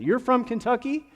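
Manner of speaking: put-on high, loud country-accent question; super country accent. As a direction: imitate a stranger's heavy country twang, excitedly asking. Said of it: "super country accent".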